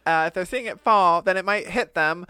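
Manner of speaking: rising to a high, panicky voice; panicked NASA staff. Retold or imitated. A NASA scientist freaking out about being seen, high and panicky. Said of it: "they were like".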